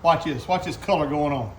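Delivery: normal talking voice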